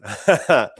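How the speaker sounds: a quick amused laugh; short laugh. Real, not acted. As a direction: laugh at your own joke. Laughs at own joke.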